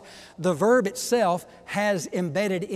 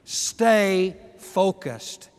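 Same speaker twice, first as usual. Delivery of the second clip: louder, higher, slow and forceful preaching; preaching at full force; 5 semitones higher, 9 dB louder than usual. Acted. Pastor urging his congregation to stay focused, full-force preaching. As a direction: build to a ringing exhortation.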